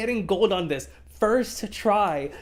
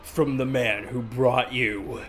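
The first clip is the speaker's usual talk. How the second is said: trailer voice; 8 semitones lower than usual. Pretend. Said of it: "imagine the trailers".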